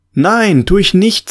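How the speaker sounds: put-on indignant boy protesting. A narrator's reading. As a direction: push back like a boy accused of lying. Alfred protests.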